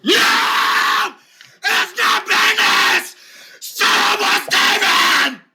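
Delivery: high, slow wailing protest; patient crying out against the name; whiny shout. Acted. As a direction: howl the denial in despair. K Rob character shouts denial.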